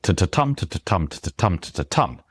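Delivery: "to, to tum" with two light taps then a strong "tum"; galloping "to, to tum", stress on every third beat; three-beat rhythm. Shown as a demonstration. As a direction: chant "to, to tum" evenly. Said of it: "there are other rhythms".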